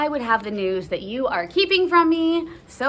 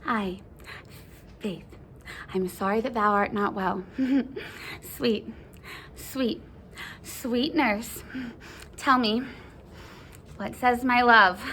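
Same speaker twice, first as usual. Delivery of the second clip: coaxing sympathy; 4 dB quieter than usual. Acted. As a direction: soothe the nurse to win the news. Juliet soothes nurse, coaxing for news.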